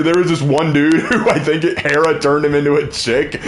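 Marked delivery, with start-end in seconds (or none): none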